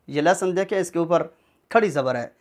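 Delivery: ordinary talk, nothing marked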